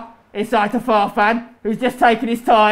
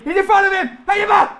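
high, frantic match call; excited high shout; urgent shouting; 9 semitones higher, 4 dB louder, faster than usual. Real, not acted. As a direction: yell it mid-attack. Urgent calling during play.

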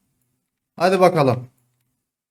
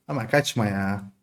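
normal talking voice

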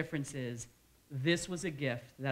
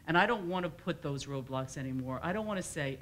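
normal talking voice

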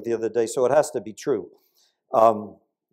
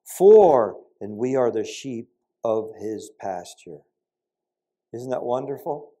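usual speaking voice

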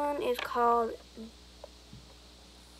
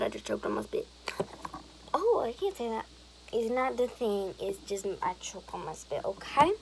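ordinary talk

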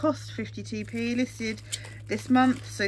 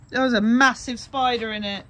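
alarmed; 9 dB louder than usual. Real, not acted. Spider found in crate.

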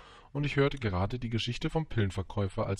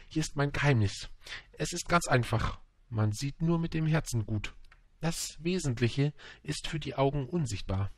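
higher, earnest fox voice; measured, higher storybook fox; fox sharing his secret; 6 semitones higher than usual. Acted. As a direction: confide a simple truth, pitched up.

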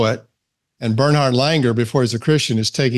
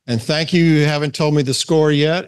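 ordinary talk, nothing marked

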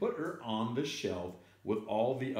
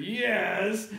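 loud, high, drawn-out insistence; emphatic acted holler; arguing cashier; 10 semitones higher, 5 dB louder than usual. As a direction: shout back stubbornly. Cashier in escalating yelling argument.

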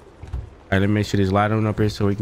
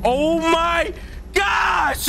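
high terrified shriek; screaming in fright; scared horror game streamer; much slower than usual. Real, not acted.

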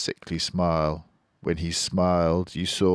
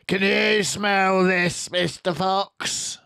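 high, louder, slow taunt; shouted; 14 semitones higher, 5 dB louder, slower than usual. Acted. Boggis shouting down the hole, taunting. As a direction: tease him loudly and slowly. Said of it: "he shouted".

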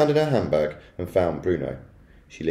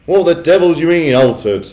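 loud, raised-pitch demand; blustering, indignant outburst; outraged shout; 9 semitones higher, 9 dB louder than usual. A narrator's reading. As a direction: demand an answer at full voice. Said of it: "shouted Mister Jenkins".

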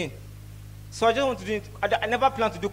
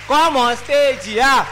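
slow loud call to come up; loud urging; 4 semitones higher, 7 dB louder, much slower than usual. Real, not acted. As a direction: beckon him loudly and slowly. Comic urging friend onto stage.

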